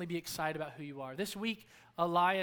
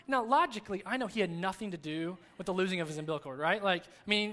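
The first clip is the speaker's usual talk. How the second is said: ordinary talk, nothing marked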